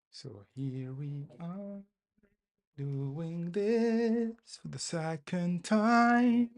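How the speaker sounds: 2 high, slow, loud groaning complaint; 3 stretch it out, fed up; 1 exasperated drawl. Real, not acted. 4 coder redoing the deploy, slow exasperated groan.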